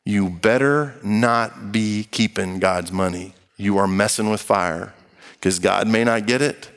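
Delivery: ordinary delivery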